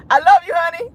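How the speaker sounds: high laughing "I love you, honey". Real, not acted. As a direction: gush it through real laughter. Laughing through a shout-out.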